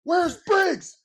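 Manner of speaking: high, drawn-out call across the field; mock yell from the sideline; coach yelling out. Coach calling for a missing rookie, mock yell. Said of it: "somebody yelling out".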